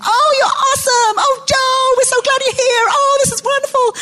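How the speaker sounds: high, gushing bad American accent greeting Joe. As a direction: cry out the name in a hammed-up American accent. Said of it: "Very bad American accent".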